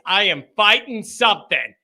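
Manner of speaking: normal talking voice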